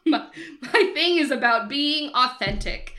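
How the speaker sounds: mocking. Mocking her repeated quote.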